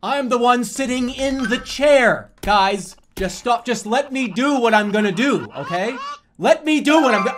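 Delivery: high, loud mock-argument shouting; exasperated yelling. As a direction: yell back at the chat, desperate and insistent. Staged argument with chat about game.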